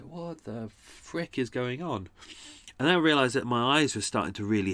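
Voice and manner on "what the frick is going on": groggy confusion. Retold or imitated. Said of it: "And I was like".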